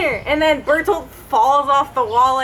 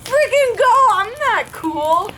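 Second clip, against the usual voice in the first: hyped crowd; 6 semitones higher than usual. Retold or imitated. Friend voicing everyone cheering each other on, high giddy mock voices. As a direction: squeal it like overexcited teens. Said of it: "everyone's like".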